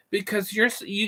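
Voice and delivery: normal talking voice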